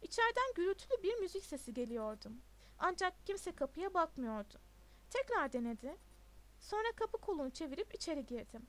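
ordinary delivery, nothing marked

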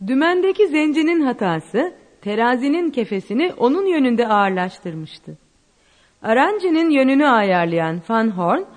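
ordinary delivery

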